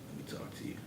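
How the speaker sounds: soft whisper of a name. Retold or imitated. Guard waking inmate at night, whispered call. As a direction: whisper so the sleepers don't wake.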